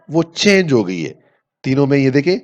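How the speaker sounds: ordinary talk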